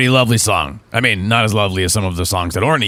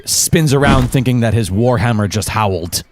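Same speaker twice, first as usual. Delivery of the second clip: ordinary talk, nothing marked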